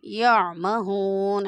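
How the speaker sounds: very slow, drawn-out recitation. Shown as a demonstration. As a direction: recite carefully, each letter clear. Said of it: "आइन को सही से आपने अदा करना है".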